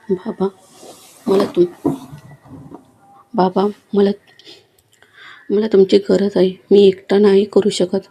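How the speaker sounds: broken, halting words through tears; crying. A narrator's reading. A son pleads with his dying father, mildly tearful. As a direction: stumble over the words like he's choking up. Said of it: "रडत असं म्हणाला".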